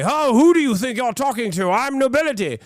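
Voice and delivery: a noble snubs the city guards, high and haughty. Retold or imitated. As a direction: look down your nose at the guard.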